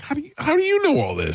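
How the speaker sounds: high, incredulous disbelief; surprised. Retold or imitated. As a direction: ask it stunned and amazed. Dad stunned by teen's drug knowledge.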